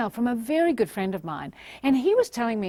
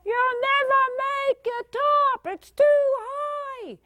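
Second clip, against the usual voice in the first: high drawn-out mock yell; slower than usual. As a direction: yell it slowly in a high, stretched voice. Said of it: "we're yelling out".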